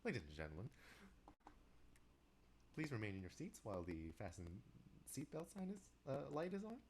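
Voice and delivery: cabin announcement with swooping, lilting pitch; air attendant. Shown as a demonstration. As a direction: address the passengers in a practised singsong. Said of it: "sound like a, you know, an air attendant".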